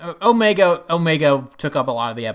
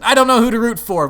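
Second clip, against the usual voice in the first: loud, high, excited outburst; exclaiming to dad; 11 semitones higher, 10 dB louder than usual. Retold or imitated. Telling dad at the show, a loud excited exclamation. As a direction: blurt it out, torn between both sides. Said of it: "I looked at my dad and I said".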